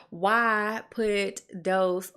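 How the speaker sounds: slow Southern drawl, "why" stretched into three syllables. Real, not acted. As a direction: drag out "why" lazily in a Southern accent. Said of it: "that was a real Southern drawl right there".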